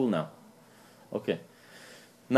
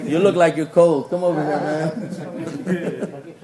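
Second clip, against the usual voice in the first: laughing invite; 4 semitones higher, 4 dB louder, slower than usual. Real, not acted. Teasing a cold youth, laughing.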